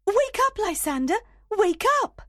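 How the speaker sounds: very high, loud wake-up call; urgent calling. A narrator's reading. Helena tries to wake Lysander, calling high and loud. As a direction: call out to rouse a sleeper.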